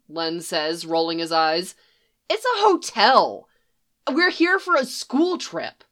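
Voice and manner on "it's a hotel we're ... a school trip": put-on high kid voice, indignant; an eleven-year-old demanding answers; high boy's voice pushing back, offended. Boy repeats himself, exasperated.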